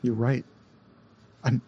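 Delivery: ordinary talk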